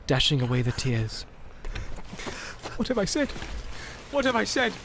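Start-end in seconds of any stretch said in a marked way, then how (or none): 2.8-4.7 much higher, stricken cry; horrified at himself; crying out in remorse. A narrator's reading. Boromir weeping over his own words, stricken. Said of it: "he cried".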